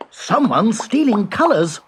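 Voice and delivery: excited cry. Acted. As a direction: cry out the discovery high and eager. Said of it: "cried Hugo".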